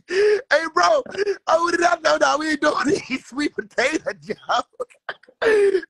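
crying with laughter; laughing shout. Real, not acted. Laughing, shouting the retold protest.

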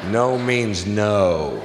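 each word slow and firm; slow and deliberate. Pretend. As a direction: lay it down slowly and pointedly. Mock-solemn punchline.

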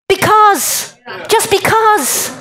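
because drawn out high and slow; emphatic because. Shown as a demonstration. Giving the meaning of ככה, slow and emphatic. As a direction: land Just because like a final answer. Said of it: "it actually means".

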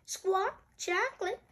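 high parrot voice; Polly. Acted. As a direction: repeat it like a parrot, rising at the end. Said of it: "Polly repeats".

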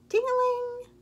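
high ringing ting-a-ling; sing-song, high-pitched bell; bell imitation. A narrator's reading. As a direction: chime it like a bike bell.

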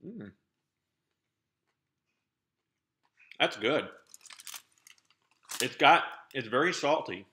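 chewing while talking; speaking between bites; mouth full of chocolate. Real, not acted. Seen 0: Puts piece in mouth at -3; lips pursed, chewing through 8.0.

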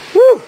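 sharp high exhale from the climb; big puff of breath after the climb; loud winded whew. Real, not acted.

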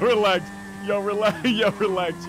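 loud, high, laughing protest. Real, not acted. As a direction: shout it through laughter.

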